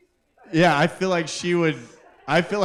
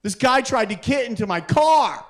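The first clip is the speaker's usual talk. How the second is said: higher, louder protest to mom; retold shout; 7 semitones higher, 4 dB louder than usual. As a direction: yell back, outraged. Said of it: "I'm like".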